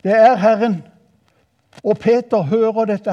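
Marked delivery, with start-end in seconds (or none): 0.0-0.8 louder, raised cry; exclaimed recognition. Acted. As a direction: call it out to the others. Same quote repeated as a cry.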